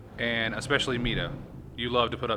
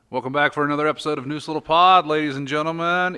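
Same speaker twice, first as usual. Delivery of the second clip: louder, upbeat host opening; show host welcome; 4 semitones higher, 5 dB louder than usual. Acted. Host opening the podcast for listeners, upbeat welcome. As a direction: welcome the audience like a showman.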